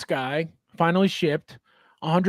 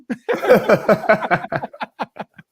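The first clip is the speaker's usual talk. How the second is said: real laughter; 2 semitones higher, 6 dB louder than usual. Real, not acted. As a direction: burst out laughing at your own joke. Laughs after own novela imitation.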